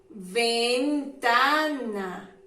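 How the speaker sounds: Ventana stretched out very slowly, syllable by syllable; very slow model word. Shown as a demonstration. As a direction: drag Ventana out so every syllable is heard. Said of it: "Contiene la sílaba V".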